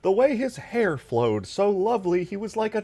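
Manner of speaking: high swooning fan voice; mocking gushing voice. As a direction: gush over the hair dreamily. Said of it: "your mocking voice is Hank Hill".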